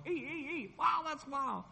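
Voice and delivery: high-pitched squeaks running into amazement; high "ee" imitation. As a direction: squeak the "ee"s, then marvel up high. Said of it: "I went, "Ee, ee, ee".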